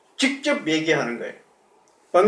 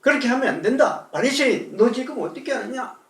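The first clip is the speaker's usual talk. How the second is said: scolding; 6 semitones higher than usual. Retold or imitated. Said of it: "꾸짖어요".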